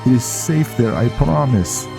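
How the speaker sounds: Viking defending himself. Acted. Viking promising Velen the armor is safe, animated.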